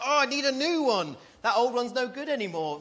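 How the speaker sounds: hammed-up craving, bigger still; mock craving. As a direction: overblown consumer desperate for the latest model. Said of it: "and you're like".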